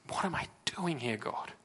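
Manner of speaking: hushed whisper to God; whispered, lost and alone; whispered prayer. Acted. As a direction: breathe it out as a desperate secret plea. Voiced despairing prayer in sermon.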